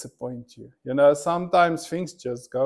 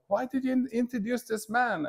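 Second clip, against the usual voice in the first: higher, plaintive boss quote; lightly voiced upset boss, higher; mock upset boss; 9 semitones higher, 6 dB quieter than usual. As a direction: imitate the boss's hurt complaint.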